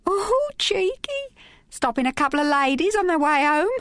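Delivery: high Cockney tart voice, teasing; Cockney accent. A narrator's reading. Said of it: "in the same accent after a certain excursus into Cockney".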